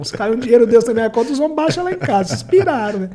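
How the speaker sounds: high, loud, worked-up disbelief; excited disbelief. Retold or imitated. Telling the bank man he's crazy, high loud disbelief. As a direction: blurt it out in shocked excitement. Favela guy reacting to big contract.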